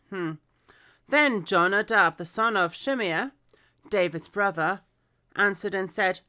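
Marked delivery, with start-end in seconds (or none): 1.1-6.2 clear, projected story reading; scripture read-aloud. Acted. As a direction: read the Bible story out with expression.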